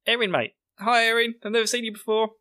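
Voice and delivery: high-pitched mock greeting; voiced girl character. Blonde girl greeting Eren, imitated in high voice.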